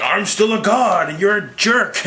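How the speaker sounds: high, drawn-out petulant retort; hammed-up slow comeback; mock indignant god. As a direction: act out a pompous god squabbling. Voicing Loki mid-fight.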